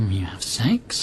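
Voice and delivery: hushed, scandalised whisper. Acted. Prisoner whispers back at the intermediator, shocked hush. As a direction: breathe the question, shocked. Intimate surprised question.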